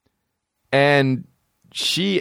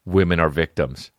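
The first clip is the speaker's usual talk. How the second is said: ordinary talk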